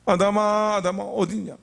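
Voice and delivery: searching call, vowels held long; God calling out. Acted. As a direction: call out slowly for someone hidden. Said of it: "창세기 말씀 보면요".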